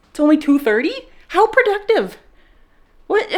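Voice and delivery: high, loud surprised exclaim; mock amazement. Real, not acted. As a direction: act astonished at yourself. Amazed at own productivity.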